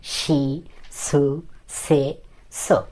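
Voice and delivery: very slow, separated syllables; slow model recitation with gaps between sounds; reading kana aloud. Shown as a demonstration. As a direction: stretch each vowel for learners to copy. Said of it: "এভাবে পড়বেন।".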